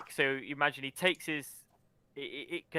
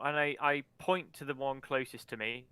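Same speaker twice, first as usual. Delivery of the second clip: usual speaking voice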